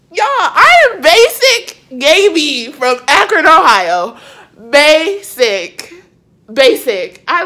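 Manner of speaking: loud, emphatic gushing; excited proclaim. Real, not acted. Vlogger shouting to viewers about being basic, loud. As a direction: proclaim it proudly, overjoyed.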